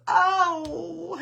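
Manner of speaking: loud, high mock toddler wail; imitated crying wail. Grandmother imitating grandson after a consoling kiss, mock wail. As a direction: burst into a hammed-up "oh" of crying. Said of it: "and he starts crying".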